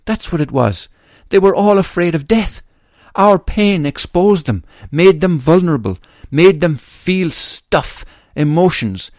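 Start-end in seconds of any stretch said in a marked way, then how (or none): 1.3-2.6 pitched up high and pressed harder; rising emphatic repeat. Real, not acted. Father repeating his point, higher and louder. 3.1-9.1 raised high and loud, slowing on each phrase; impassioned. Real, not acted. Grieving father accusing mourners, raised and slow.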